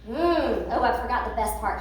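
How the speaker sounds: ordinary talk, nothing marked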